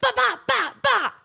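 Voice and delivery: string of high, loud "bah" yelps; retold video noise. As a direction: fire off high, loud bahs. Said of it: "I was going".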